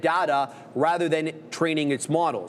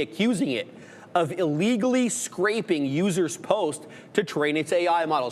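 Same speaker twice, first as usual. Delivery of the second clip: ordinary talk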